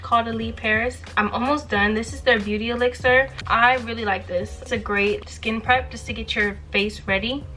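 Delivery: normal talking voice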